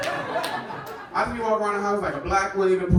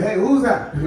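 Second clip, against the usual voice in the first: surprised shout, louder, higher; startled by yourself; 5 semitones higher, 6 dB louder than usual. Retold or imitated. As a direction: jump at your own reflection. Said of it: "You're like".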